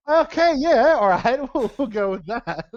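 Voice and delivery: high, loud and incredulous okay; bemused mock surprise. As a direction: play along with a baffled laugh. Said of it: "And I'm like".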